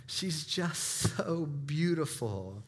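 slow, choked-up imitation of a crying friend; mock tearful. Imitating a friend crying over a baby, slow and tearful. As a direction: drag it out like a weepy friend. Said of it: "he started crying and said".

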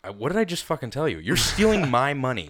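mock furious bar bouncer; retold angry bouncer. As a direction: tell him off and throw him out. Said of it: "I'm like".

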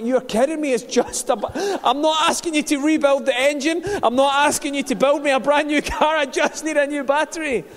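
retold exasperation at the garage, pitched well up; exasperated protest. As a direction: argue with the garage, disbelieving. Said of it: "I'm like".

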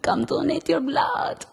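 slow Dracula-style vampire with a mock Russian flavor; dressed as vampires at the blood drive, slow and campy. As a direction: drag it out like a hammed-up count. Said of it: "um, vampire".

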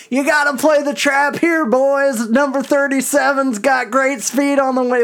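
high, loud shout at the players, like a self-appointed coach; armchair coach shout. Retold or imitated. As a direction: bark orders from the stands like you're behind the bench. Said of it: "who thinks he's the coach".

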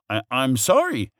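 high, loud, stammered apology; scared dragon tripping over words; spluttering. A narrator's reading. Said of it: "he spluttered".

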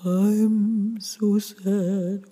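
very slow, drawn-out sob; hooty, sustained sobbing tone. Shown as a demonstration.

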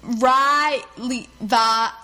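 "Riley" stretched syllable by syllable; exaggerated, drawn-out "Riley", as if coaching pronunciation; sounding out a name. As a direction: teach "Riley" slowly, each syllable held apart. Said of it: "trying to tell her how to pronounce her name".

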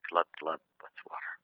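whispered fish reply; breathy little fish whisper; a fish whispering back. Retold or imitated. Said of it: "and the fish goes".

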